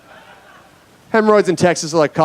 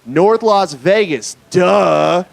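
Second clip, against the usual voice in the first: slow, drawn-out mock condescension; scornful local; 3 semitones higher, much slower than usual. Vegas local answers a dumb question, slow scorn. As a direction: spell out the obvious with a long duh. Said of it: "look at you like you're an idiot".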